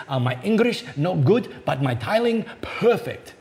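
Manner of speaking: the tiler boasts of his tiling in a bad Korean-Australian accent; cheerful Korean-Australian accent, proud of his tiling. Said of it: "a very bad Korean, Australian accent coming".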